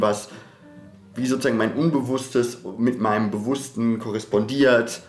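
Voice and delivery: usual speaking voice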